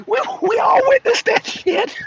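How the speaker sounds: high voice through real laughter; laughing incredulously. Real, not acted. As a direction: crack up while insisting on it. Cracks up after his impression.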